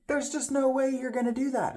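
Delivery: high-pitched imitation of incredulous onlookers; disbelieving. Staff doubt his packing plan.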